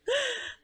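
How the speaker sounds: quick high startled gasp; sharp gasp. Real, not acted. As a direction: caught off guard, gasp. Startled while handling book.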